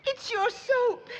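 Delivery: high, slow, sobbing complaint; weepy wail over the soap; crying. Acted. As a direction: blubber it out through tears. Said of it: "what's got you to bawling".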